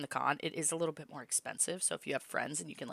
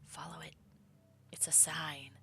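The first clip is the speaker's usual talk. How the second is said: whispering; 10 dB quieter than usual. Acted. Dream voice urging the player.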